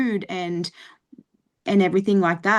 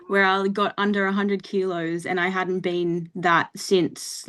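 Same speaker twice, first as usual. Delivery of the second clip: normal talking voice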